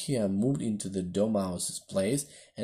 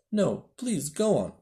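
ordinary delivery, nothing marked

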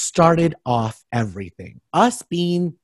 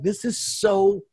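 weirded-out drawl; 4 semitones higher, much slower than usual. Retold or imitated. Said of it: "I'm just like".

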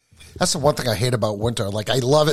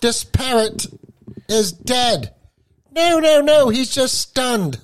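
high-pitched comic sketch voice, stretched out; hammed-up falsetto parody of a sketch; Monty Python; 12 semitones higher, 3 dB louder, much slower than usual. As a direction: squawk it high, drawing out the last words. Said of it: "Like Monty Python".

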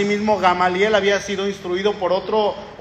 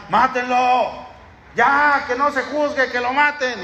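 a hostile crowd demanding death, slow and higher; mock angry mob voice; angry mob cry; 5 semitones higher, slower than usual.

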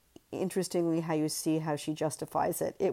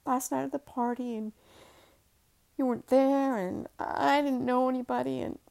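lightly raised pleading voice; higher, wistful young wife; woman's voice; 8 semitones higher than usual. Acted. Wife voiced in dream scene.

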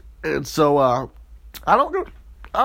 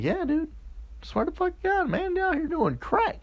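mock friends; 12 semitones higher, 8 dB quieter than usual. Friends confirm, voiced high and soft.

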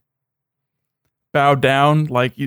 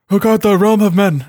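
higher-pitched mock Northern English bark; Northman bark; 6 semitones higher, 4 dB louder than usual. Imitating a TV character's weird voice, Northman bark. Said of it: "Northman bark to it".

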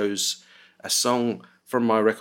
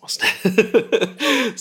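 squeaky question; 13 semitones higher, 7 dB louder than usual. Pretend. Squeaky joke after whisper.

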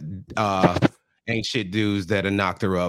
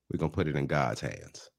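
low, near-whispered mock-solemn line; hushed solemnity; 9 semitones lower, 9 dB quieter than usual. Boyfriend telling the mother his plan, hushed. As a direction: drop to a hush for the big reveal.

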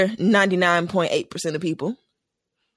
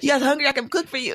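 higher-pitched mock offer to cook; lightly voiced Meredith; 7 semitones higher, faster than usual. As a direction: lightly imitate a woman offering food, amused. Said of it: "And she was like".

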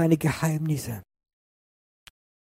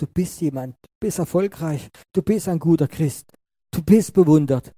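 building preaching emphasis; 4 semitones higher, 8 dB louder than usual. Acted. Builds a list at preaching force.

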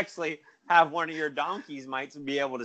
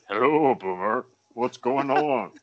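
lightly voiced donkey greeting; a hint of a goofy donkey saying hi; barely-changed silly donkey voice; talking donkey voice; slower than usual. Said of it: "Why does he talk like that?".

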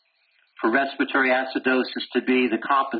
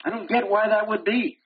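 higher, whiny puzzled student; a touch of a baffled student; mock confused student; 7 semitones higher than usual. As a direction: play the student who doesn't get it. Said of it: "How do students screw that up?".

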